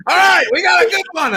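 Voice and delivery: high, loud call to the staff. Retold or imitated. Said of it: "She's calling in people".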